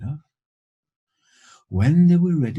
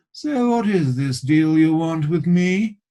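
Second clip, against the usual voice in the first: put-on slow, raised, louder posh English accent; haughty posh English ruler's voice; 5 semitones higher, 5 dB louder, slower than usual. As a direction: lord it over them in a posh English accent. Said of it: "he asked in a rather posh accent".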